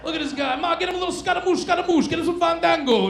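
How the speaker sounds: high, fast mock-Italian gibberish; high playful fake Italian; mock Italian host. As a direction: rattle off fake Italian words. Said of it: "Just making words up".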